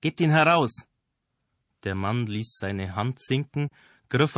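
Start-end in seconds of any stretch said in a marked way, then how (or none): 0.0-0.9 high, loud threatening order; loud demand. Acted. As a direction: bark the demand at them. Armed man demands the murderer.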